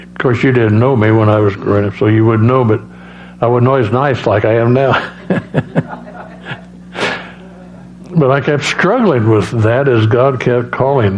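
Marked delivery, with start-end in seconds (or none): 5.0-5.9 low-key breathy laugh at his own joke; soft chuckle. Real, not acted. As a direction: chuckle softly to yourself.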